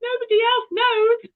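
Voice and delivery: high, slow cry of futility; mock anguish, pitched high; despairing wail. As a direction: wail it out like nobody cares. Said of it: "And then you think".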